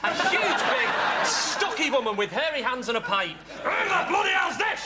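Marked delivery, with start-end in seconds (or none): 3.6-4.9 angry shout. Acted. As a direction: bellow at the stranger in the flat. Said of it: "she shouts".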